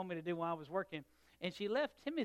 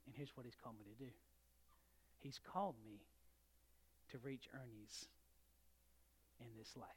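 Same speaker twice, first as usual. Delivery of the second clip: quiet emphasis; 5 semitones lower, 13 dB quieter than usual. Acted. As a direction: drop the voice so they lean in. Preacher's quiet key point.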